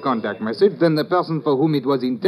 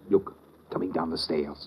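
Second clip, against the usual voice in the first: hushed warning; 9 semitones lower, 10 dB quieter than usual. Acted. Spotting suspect on the stairs.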